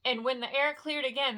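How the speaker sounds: plain reading voice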